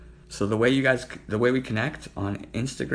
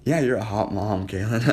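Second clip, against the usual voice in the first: usual speaking voice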